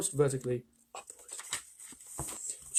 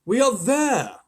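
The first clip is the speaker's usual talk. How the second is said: put-on higher, louder old grasshopper; forced cheer; 8 semitones higher, 8 dB louder, much slower than usual. A narrator's reading. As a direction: announce it brightly to cheer them up. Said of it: "trying to be cheerful".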